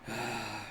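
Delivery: low, soft exhale; acted sigh. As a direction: sigh it out, giving up. Acted weary sigh of Zeka.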